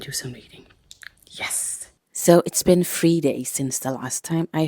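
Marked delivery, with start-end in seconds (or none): 0.0-1.9 whispering. Real, not acted. Whispering at night.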